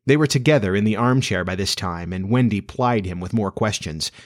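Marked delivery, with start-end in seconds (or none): none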